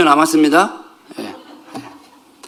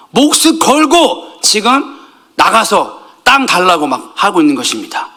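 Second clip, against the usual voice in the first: ordinary talk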